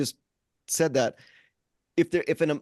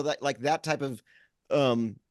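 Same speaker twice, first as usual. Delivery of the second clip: usual speaking voice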